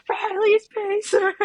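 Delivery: high, slow mock call for the pacer; drawn-out high imitation of a worried friend; Riley mimics Katie calling out Riley's pacer, high and slow; mimicking Katie. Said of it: "Katie being like".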